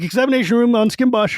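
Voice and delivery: high gnome rattling off words; fast gnome announcement. Retold or imitated. Gnome guard announces the destination, high and rushed. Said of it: "And then I'm like".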